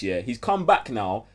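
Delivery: usual speaking voice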